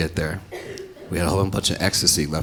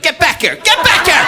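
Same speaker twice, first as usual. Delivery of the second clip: much higher, louder shout after him; calling after; 9 dB louder than usual. Retold or imitated. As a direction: yell for him to come back.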